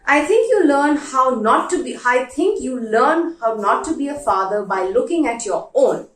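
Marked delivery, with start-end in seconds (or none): none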